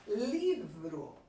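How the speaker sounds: livro with a long, strong LI; stress on LI. Shown as a demonstration. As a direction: lean hard on the first syllable of livro. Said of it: "a sílaba mais forte é a sílaba li".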